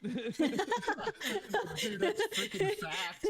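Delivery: high, loud talk through laughter; laughing hard in agreement; slow words squeezed out between real laughs; cracking up. Real, not acted. Cracking up at the venue remark.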